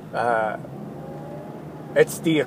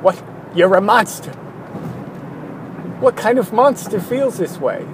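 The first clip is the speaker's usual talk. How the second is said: higher, outraged partygoer gasping at the idea; mock shocked guest; 6 semitones higher than usual. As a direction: play the horrified mixer guest, a bit hammed.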